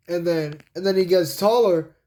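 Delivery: plain reading voice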